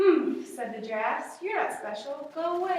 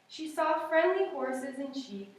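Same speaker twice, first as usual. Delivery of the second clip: usual speaking voice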